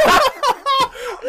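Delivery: high, loud burst of laughter; helpless laughing fit. Real, not acted. Laughing at Jason's retort.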